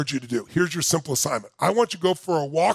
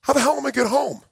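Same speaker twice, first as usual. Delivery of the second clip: shocked disbelief; 5 semitones higher than usual. Retold or imitated.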